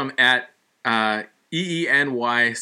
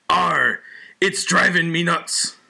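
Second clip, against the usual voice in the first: higher, slow pirate growl; 5 semitones higher, slower than usual. Acted. Reading the joke's pirate punchline as a pirate. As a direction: give it the pirate's arr.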